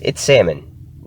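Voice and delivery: "salmon" corrected to SAM-un, no L; silent L in salmon. Shown as a demonstration. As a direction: drop the L from "salmon". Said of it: "Please pronounce it as salmon".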